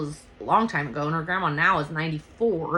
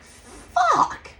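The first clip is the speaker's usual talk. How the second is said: high, loud drawn-out curse; mock high-pitched yell; exasperated outburst; 6 dB louder than usual. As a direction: let a panicked swear burst out high. Acts out her panicked reaction.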